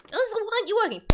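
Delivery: high, loud villain voice; high-pitched frustrated trickster; baffled Boggle. Acted. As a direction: demand an answer from a useless wand. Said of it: "Boggle kind of looks at it ... goes".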